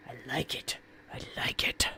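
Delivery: breathy whisper fading away; whispered repeat; whispered delight. Acted. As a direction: savour it in a secret whisper.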